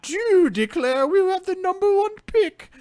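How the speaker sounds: a pompous gentleman in a monocle; sophisticated meatball. Acted. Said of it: "So I'm a sophisticated meatball".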